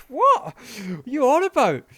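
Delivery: loud, very high-pitched disbelief; incredulous. Retold or imitated. Baffled man answering the stranger's sprint claim, acted incredulous outburst. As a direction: squawk it back, totally baffled. Acts out his baffled reply.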